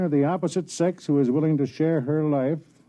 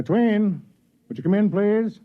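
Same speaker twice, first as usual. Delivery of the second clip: raised voice calling into the next room; 9 semitones higher, 4 dB louder, slower than usual. Acted. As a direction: call her in, pitched up and louder.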